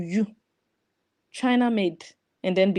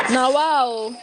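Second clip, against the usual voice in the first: louder, higher mocking wow; a hammed-up wow; 3 semitones higher, 5 dB louder than usual. Mocking the pot seller, loud sarcastic wow.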